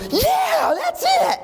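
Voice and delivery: put-on a kid overjoyed at finally being understood; thrilled shout. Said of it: "the kid looks at him and goes".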